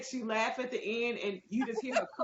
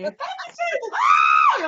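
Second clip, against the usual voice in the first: very high, loud shriek of delight; shrieking food reviewer; imitating a delighted scream; 15 dB louder, much slower than usual. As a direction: scream it like the food just blew your mind. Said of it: "she starts screaming and saying".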